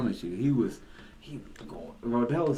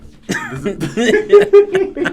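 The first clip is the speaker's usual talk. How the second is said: loud laugh; 16 dB louder than usual. Real, not acted.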